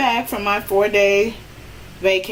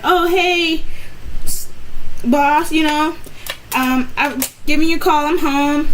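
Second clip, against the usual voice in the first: forced cheerful greeting; 6 semitones higher, 3 dB louder than usual. Retold or imitated. Calling boss, overly cheerful.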